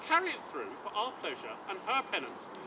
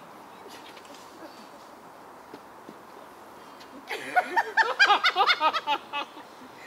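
high burst of laughter; 9 semitones higher than usual. Real, not acted. Sir Toby laughing after Malvolio leaves. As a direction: laugh out at the joke.

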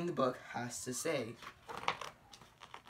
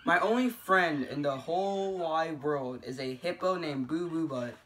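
usual speaking voice

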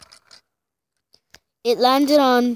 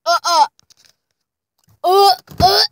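loud, higher retching uhs; gagging groans; 6 semitones higher, 5 dB louder than usual. Real, not acted. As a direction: gag on the nasty bean. Gagging on moldy bean.

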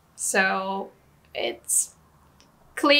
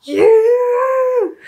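very high, very loud furious shout; hammed-up anime villain yell; angry villain shout; 13 semitones higher, 10 dB louder than usual. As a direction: scream it at your sworn enemy like an anime villain. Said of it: "when the villain is very angry".

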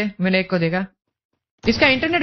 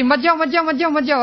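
higher, rapid repeated don't go; urgent pleading; 6 semitones higher than usual. Real, not acted. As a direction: call out to stop your teammate. Urging teammate not to go. (ऊँची आवाज़ में बेचैन पुकार)

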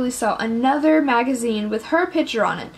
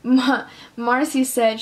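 plain reading voice

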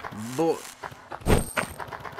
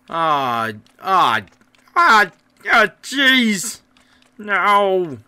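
real alarmed recoil; 8 semitones higher, 10 dB louder, much slower than usual. Real, not acted. Player recoils from the game scene.

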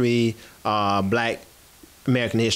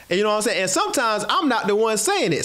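normal talking voice

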